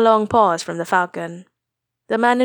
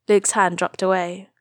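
ordinary delivery, nothing marked

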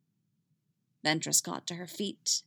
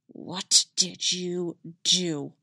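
hissing; much slower than usual. A narrator's reading. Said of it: "she hissed at him".

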